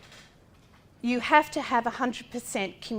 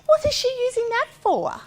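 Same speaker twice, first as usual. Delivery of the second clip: high, louder snide question; mocking a nosy parent; sneering parent; 12 semitones higher, 6 dB louder than usual. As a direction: sneer it like a judgmental passer-by.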